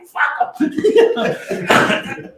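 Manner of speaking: high laughing voice; laughing quote. Retold or imitated. Jack agreeing through laughter, high and laughing. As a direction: blurt it while cracking up. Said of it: "He goes".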